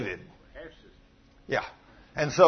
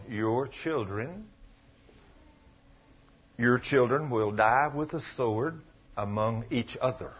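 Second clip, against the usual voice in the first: solemn divine judgment; 6 semitones lower, 7 dB quieter than usual. Retold or imitated. God's judgment voiced by prophet.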